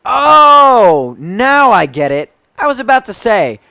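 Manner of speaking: high, loud dawning oh; light bulb moment, said out loud; happy surprise at finally understanding; sudden realization. Real, not acted.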